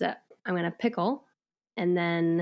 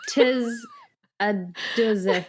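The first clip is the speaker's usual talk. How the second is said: mock Elizabethan; 3 semitones higher, 3 dB louder than usual. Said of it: "'Cause you said".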